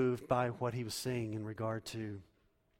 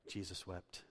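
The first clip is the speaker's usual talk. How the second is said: hushed; 4 semitones lower, 10 dB quieter, slower than usual. Acted. Repeats shortest verse softly.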